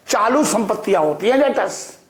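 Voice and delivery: ordinary talk, nothing marked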